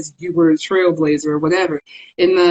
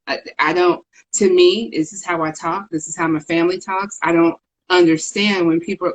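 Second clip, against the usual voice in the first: ordinary talk, nothing marked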